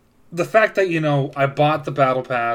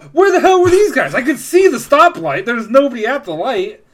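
much higher, louder exasperated outburst; exasperated rant; 11 semitones higher, 8 dB louder than usual. Retold or imitated. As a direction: vent the frustration at full volume. Ranting about empty stoplight.

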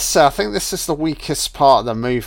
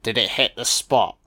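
ordinary delivery

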